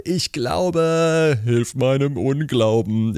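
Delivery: high, drawn-out cry without much added volume; desperate cry. Acted. Father of the sick child begging Jesus, acted cry. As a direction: cry out high, stretching the words. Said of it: "schrie der Vater des Kindes".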